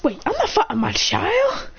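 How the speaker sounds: high, drawn-out disbelief; taken aback. Acted. Naruto refusing to fight his child, high-pitched disbelief. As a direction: protest in a high, slowed voice.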